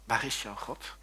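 low, soft repeated question; hushed, insinuating challenge; quiet taunt. Retold or imitated. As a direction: needle the listener softly. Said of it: "roept hij tegen jou".

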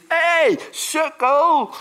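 high, loud mocking shout of sukkel; mock yell. Preacher mocks a harsh spirit with a shouted insult. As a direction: bark it like a bully, hammed up. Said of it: "de geest van God die zegt niet".

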